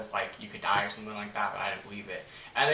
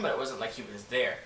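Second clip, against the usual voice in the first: ordinary talk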